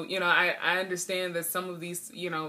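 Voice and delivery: normal talking voice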